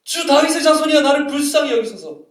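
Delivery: loud, high shout for mercy; imitated desperate cry. Woman on the roadside shouting to Jesus for mercy, loud cry. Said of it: "길바닥에 앉아 소리 지릅니다".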